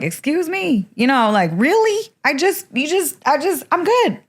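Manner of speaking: high, incredulous and offended protest; mock outrage at being shipped off again; indignant outrage. Teen to parents about boarding school, high indignant disbelief. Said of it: "that pissed me off".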